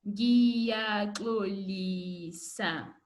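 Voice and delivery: "Ngiyaxolisa" very slow, syllable by syllable; slow drill word. Shown as a demonstration. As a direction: drag out "Ngiyaxolisa" for pupils to copy. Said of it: "say this word with me".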